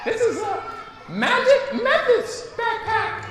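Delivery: very high fairy voice with giggles. Acted. Teacher reading the fairy's lines, high and giggling. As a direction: squeak it like a giggly little fairy. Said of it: "the fairy voice".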